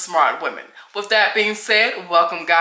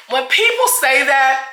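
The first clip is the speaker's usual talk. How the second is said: ordinary talk, nothing marked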